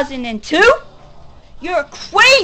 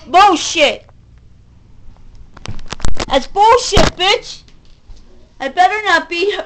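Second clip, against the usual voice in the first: angry; 4 semitones higher than usual. Real, not acted. Furious rant at rival.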